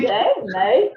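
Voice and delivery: loud, amateur Australian accent. Shown as a demonstration. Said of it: "in an Australian accent".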